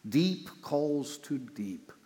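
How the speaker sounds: slow, soft, reverent repetition; savored slowly. Shown as a demonstration. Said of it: "Isn't that brilliant poetry?".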